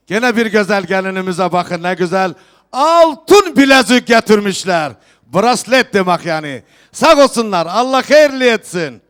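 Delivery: loud festive proclamation to the hall; turkish wedding announcer. Real, not acted. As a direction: call out each gift to the guests. Host proclaims gifts at wedding.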